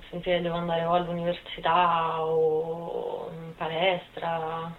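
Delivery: ordinary talk, nothing marked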